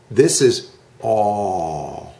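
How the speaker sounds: aw drawn out slowly with the mouth dropped; open aw sound. Shown as a demonstration. Teacher shows the open aw, slow and drawn out. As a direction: stretch aw, jaw down. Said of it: "move your mouth down".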